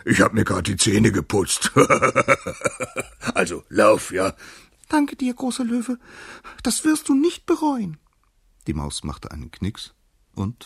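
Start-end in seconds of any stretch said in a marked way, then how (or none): 0.0-4.3 lion chuckling at his own joke; good-humoured joking. A narrator's reading. Lion letting the mouse go with a joke, laughing. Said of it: "so gut gelaunt, dass er sogar einen Scherz". 4.9-8.0 very high little mouse voice; squeaky mouse. A narrator's reading. Mouse thanking the lion for freeing it, squeaky.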